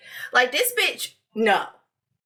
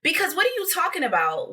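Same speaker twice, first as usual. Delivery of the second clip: ordinary talk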